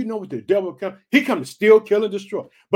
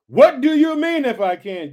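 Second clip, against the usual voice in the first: Jesus rebuking; 7 semitones higher, 9 dB louder than usual. Retold or imitated. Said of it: "He says this".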